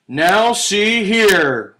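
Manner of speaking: big, slow, loud bear roar; roaring Papa Bear. A narrator's reading. Storyteller to children, Papa Bear roaring at the intruder. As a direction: boom it out like a cross father bear. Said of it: "roared Papa Bear".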